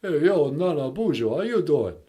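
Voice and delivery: high, comic, lightly done Rocky voice; Sylvester Stallone impression. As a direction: play a goofy Stallone, high and hammed-up. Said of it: "it's Sylvester Stallone".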